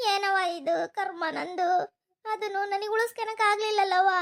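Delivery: tearful lament, slowing with grief; weeping as you speak; crying. Acted. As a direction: mourn a lost baby through tears. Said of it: "ಅಳ್ಬೇಡ, ಸುಮ್ನಿರವ್ವ".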